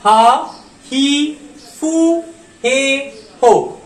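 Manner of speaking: हा ही फू हे हो each given its own clear beat; syllable drill. Shown as a demonstration. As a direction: drill हा ही फू हे हो for the class. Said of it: "हा line में टोटल फ़ाइव लेटर्स".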